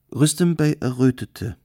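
ordinary delivery

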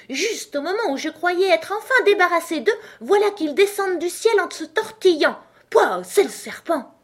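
high, loud pigeon squawk; shrill cry. A narrator's reading. Pigeon railing at serpents dropping from the sky, shrill cry. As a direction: cry out in high-pitched exasperation. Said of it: "devenir un cri aigu".

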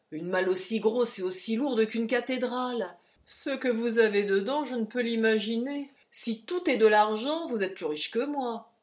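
loud, grumbling porter. Acted. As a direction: complain about the heavy trunk. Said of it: "grommela le porteur".

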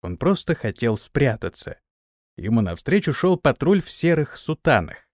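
ordinary delivery

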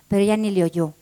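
plain reading voice

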